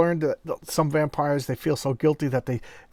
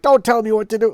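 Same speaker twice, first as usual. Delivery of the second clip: loud, high mock snap at the assistant; lashing out; 9 semitones higher, 10 dB louder than usual. Hunter lashing out at his assistant, loud mock anger. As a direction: bark it like a bully who hates being corrected. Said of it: "he's still lashing out".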